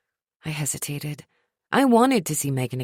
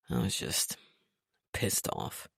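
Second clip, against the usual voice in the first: low, soft sheepish mumble; 11 dB quieter, slower than usual. A narrator's reading. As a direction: mumble it half-voiced. Trent, low.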